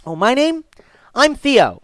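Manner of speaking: put-on high, loud little-boy voice; eager young boy. Boy telling a customer his name, young and eager. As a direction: pipe up as an excited kid.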